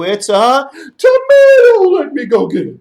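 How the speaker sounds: high, loud sniffing excitement; excited elephant. Acted. Elephant smelling the soup, loud and eager.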